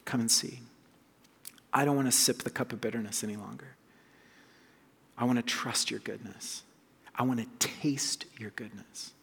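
quiet, fading into a whisper; soft prayer. Retold or imitated. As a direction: pray gently, ending in a whisper. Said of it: "welcome Jesus".

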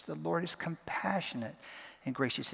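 "compassionate" leaned on, pause before the quiet ending; single out "compassionate", then drop softly; weighty "compassionate" with a beat after it; stress on COMPASSIONATE. Shown as a demonstration. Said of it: "putting emphasis on one word at a time".

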